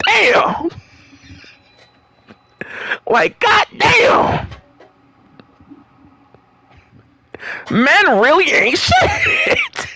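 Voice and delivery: high, slow drawn-out disbelief; slow, high, fed-up exclaim; exasperated. Real, not acted. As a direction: drag out the outrage. Exasperated at the story.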